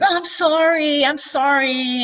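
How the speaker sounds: entity consoling the crying woman, tearful; crying apology. Retold or imitated. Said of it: "he starts crying with her".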